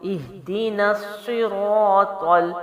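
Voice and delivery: slow recitation with الصراط, the ṭ said heavy and thick; drawn-out الصراط with full, emphatic ط. Shown as a demonstration. Teacher showing the student a heavy ط, slow demo. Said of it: "طا کو بھی جو ہے موٹا پڑھ کے".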